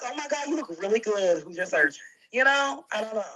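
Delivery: ordinary delivery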